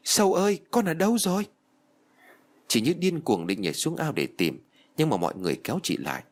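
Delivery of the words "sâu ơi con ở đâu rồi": high-pitched, mildly acted wail; narrator's restrained cry of anguish, pitched high. A narrator's reading. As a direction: call out for a lost child. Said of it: "ngồi sụp xuống đất và gào lên".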